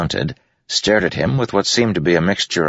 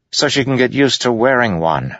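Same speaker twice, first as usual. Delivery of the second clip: stern horsewoman; 8 semitones higher than usual. A narrator's reading. Yennet voiced.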